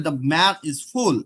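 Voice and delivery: "full" drawn out slowly in English; teacher reads "The man is full" very slowly; slow English demo. Shown as a demonstration. Teacher to student, slowly demonstrating English "full". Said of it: "ফুলটা এরকম হবে".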